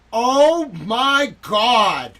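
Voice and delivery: loud, high, drawn-out cry of horror; horrified cry. Acted. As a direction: stretch it out in shocked dismay. Horrified outburst at video.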